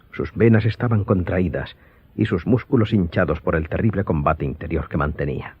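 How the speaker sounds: ordinary talk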